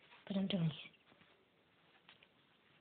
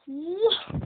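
startled yell; 10 semitones higher, 11 dB louder than usual. Real, not acted. Jump scare in a horror game.

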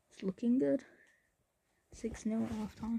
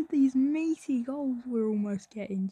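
excited; 4 semitones higher, 6 dB louder than usual. Real, not acted. Excited after near miss.

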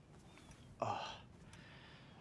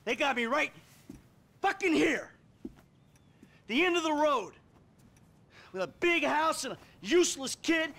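bitter rant; 10 semitones higher than usual. Acted.

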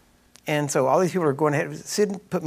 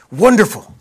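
hammed-up delight, higher and much louder, laughing; theatrical delight; 7 semitones higher, 12 dB louder than usual. Sid greeting a sick lady with theatrical delight. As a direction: overplay the joy. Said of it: "And he's all theatrical".

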